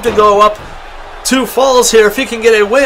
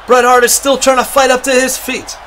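ordinary delivery

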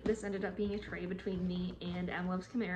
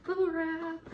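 high, loud cheer; excited unboxing exclamation; delighted; 8 semitones higher, 5 dB louder than usual. Real, not acted. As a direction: sing out with delight at the find. Delighted find while unboxing.

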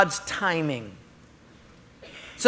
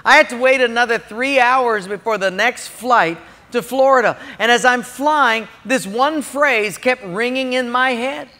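ordinary talk

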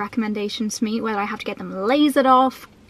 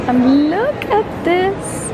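excited; 8 semitones higher, 4 dB louder, much slower than usual. Real, not acted. Showing off an adorable bag.

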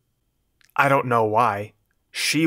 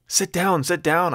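urgent; 7 semitones higher than usual. A narrator's reading. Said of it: "I told her".

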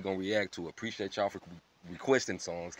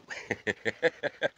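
short rapid chuckle; real easy chuckle; laugh; 4 semitones lower, 5 dB quieter than usual. Real, not acted. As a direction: laugh after the greeting. Laughs after his intro.

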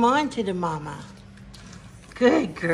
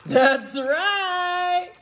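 triumphant; 8 semitones higher, much slower than usual. Real, not acted. Triumphant reveal.

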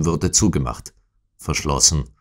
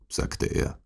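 ordinary delivery

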